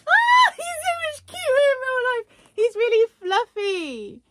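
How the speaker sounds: high, loud squeal of delight; excited squeal. Real, not acted. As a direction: squeal at how cute it is. Sees plush in real life.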